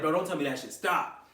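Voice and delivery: retold at normal, audible volume; retold dismay. Speaker tells viewers about the camera scare, retold dismay. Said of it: "I was like".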